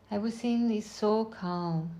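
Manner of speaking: normal talking voice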